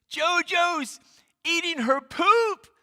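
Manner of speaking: put-on very high, loud, slow child's wail; panicked little boy. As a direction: wail it out like a horrified kid. Panicked boy reports to dad.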